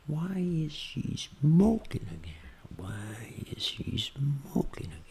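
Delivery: whispered, very slow and drawn out; train effect. Shown as a demonstration. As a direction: stretch the opening words in a whisper. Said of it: "Efeito trem". (efeito trem, sussurro bem arrastado)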